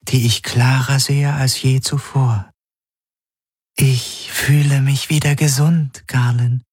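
a changing spirit confessing fear and relief; frightened glass spirit. A narrator's reading.

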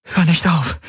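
high-pitched desperate plea; pleading. A narrator's reading. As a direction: implore in a high, acted female character voice. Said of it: "flehte sie".

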